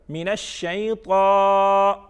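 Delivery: الشیطان held long on a level note, loud; slow chanted من الشیطان with a long final vowel; recited by parts. Shown as a demonstration. Teacher giving children the third part, slowly chanted. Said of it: "یہ ہم نے by parts پڑھ لیا".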